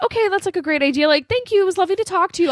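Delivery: high-pitched, polite retold sign-off; imitated grateful Wendy. Wendy thanking the ranger over the radio, high imitated voice. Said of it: "And she's like".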